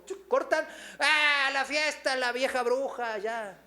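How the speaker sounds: mock outburst. Preacher mimicking a caller hanging up, mock outburst. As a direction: blurt it out in sudden mock annoyance. Mimics cut-off call, mother scolded.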